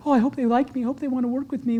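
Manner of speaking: soft, hopeful mumble; timid. Retold or imitated. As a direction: fade away like an unsure salesman. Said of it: "instead of".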